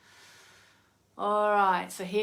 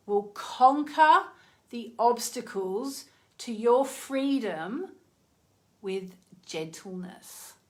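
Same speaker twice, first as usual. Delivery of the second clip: slow, solemn, uplifting reading voice; inspirational card reading; 4 semitones higher, 4 dB louder than usual. Acted. Reading the Kali card aloud to viewers, reverent and slow. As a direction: read it like a blessing. Reads oracle card message.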